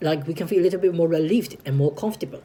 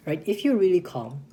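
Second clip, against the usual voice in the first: ordinary talk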